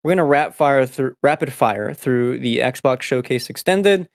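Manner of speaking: usual speaking voice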